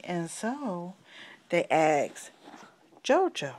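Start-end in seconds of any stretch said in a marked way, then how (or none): none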